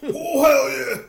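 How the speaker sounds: slow, loud high yell; wrestler catchphrase. Retold or imitated. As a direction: bellow the catchphrase.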